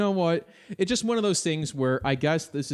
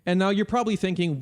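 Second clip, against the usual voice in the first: ordinary talk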